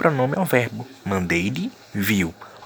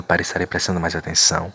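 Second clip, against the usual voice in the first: usual speaking voice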